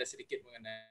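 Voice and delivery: speaking too softly to be heard; barely audible. Real, not acted. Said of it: "suaranya terlalu kecil".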